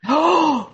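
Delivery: loud, high gasp of realization; shocked gasp. Real, not acted. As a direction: gasp loudly in sudden recognition. Struck by a participant's answer.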